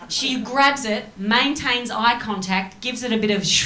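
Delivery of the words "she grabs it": normal talking voice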